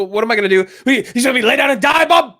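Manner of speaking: mock yell, high and loud; imitating a yell. A fan imitating Hopkins yelling at Bob, hammed-up yell. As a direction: belt it like Hopkins losing it. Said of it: "Hopkins yells".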